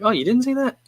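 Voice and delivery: mock gasp at someone who missed a classic; mock disbelief. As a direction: play the shocked friend. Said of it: "most people would be like".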